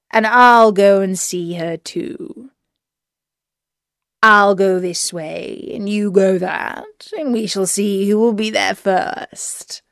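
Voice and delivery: wolf's put-on storybook voice. A narrator's reading. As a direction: play the scheming wolf, words stretched out. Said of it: "said the wolf".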